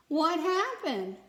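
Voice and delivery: high, loud, slow bewildered cry; a tree calling out in confusion; confused cry. Acted. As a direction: wonder aloud, lost and hurt. Tree's confused question.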